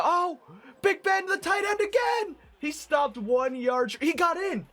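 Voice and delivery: high, loud shout at the play; real thrill as the tight end scores; yelling live at the game; excited yelling. Real, not acted. Yelling as touchdown play unfolds.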